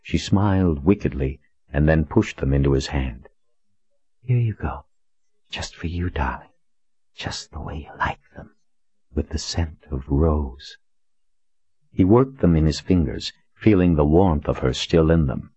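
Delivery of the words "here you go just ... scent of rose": hushed, breathy seductive voice; sultry whisper. A narrator's reading. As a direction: breathe it close to his ear. Courtesan Rose speaks.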